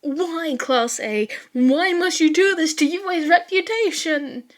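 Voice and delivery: despairing cry. Acted. Teacher despairing over class.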